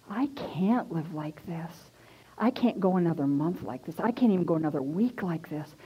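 low, hushed despairing thought; near-whispered inner voice, low and defeated; quiet despair. Retold or imitated. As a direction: say it softly to yourself, worn down. Acted despairing thought to herself.

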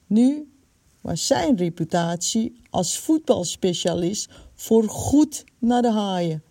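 ordinary delivery, nothing marked

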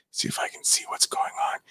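whispered aside to himself; whispering. Real, not acted. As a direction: whisper it under your breath. Muttering while checking the chat.

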